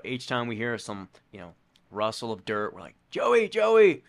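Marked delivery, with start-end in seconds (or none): 3.1-4.0 calling out. Retold or imitated. Calling for runner in dark.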